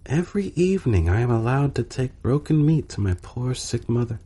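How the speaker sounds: poor little maid, eager and touched; little kitchen maid. A narrator's reading.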